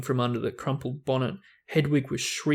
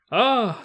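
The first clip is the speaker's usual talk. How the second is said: high, louder startled ah; startled cry; 9 semitones higher, 6 dB louder than usual. Acted. Ron as a limb dents the door, startled cry. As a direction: yelp as the branch hits. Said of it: "said Ron".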